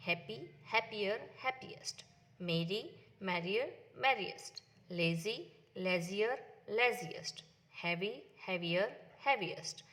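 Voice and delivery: each form spelled out slowly for the class; teacher modelling comparatives slowly; slow drilled word forms. Shown as a demonstration. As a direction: read the forms slowly and clearly, like dictation. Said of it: "teacher models the word forms".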